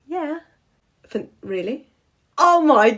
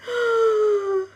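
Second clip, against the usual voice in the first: shocked gasp; 9 semitones higher, 4 dB quieter than usual. Acted. Shocked at mispronunciation.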